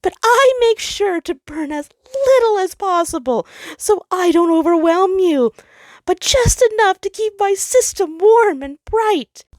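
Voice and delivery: high, loud anguished outburst; the sun crying out at Jupiter, high and loud. Acted. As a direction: pour out every grievance at once. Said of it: "making him cry out".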